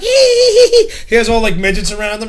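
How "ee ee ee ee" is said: shrill squeals, very high; high squealing "ee". Retold or imitated. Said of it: "and he, like".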